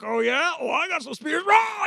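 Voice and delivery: high, loud mock war cry; hammed-up fighting back, ending in a shout; combative retort. Preacher acting out his younger self barking back in a fight. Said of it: "and I'm like".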